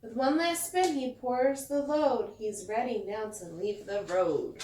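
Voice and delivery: lively picture-book read-aloud; storyteller reading to kids; reading to children. A narrator's reading. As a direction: read it like bedtime story time.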